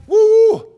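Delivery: high loud whoop of delight. Real, not acted. Preacher whooping to fire up the congregation, loud whoop. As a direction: let out a big excited woo.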